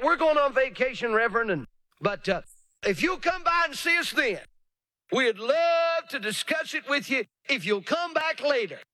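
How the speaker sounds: high, sugary put-off voice; mock polite delayer. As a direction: fob off the reverend sweetly. Delayer brushing off the preacher.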